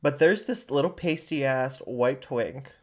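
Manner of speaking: ordinary talk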